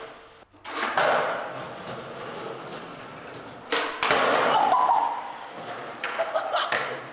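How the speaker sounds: loud, high, breathy laugh; high burst of laughter. Real, not acted. Laughs after landing trick.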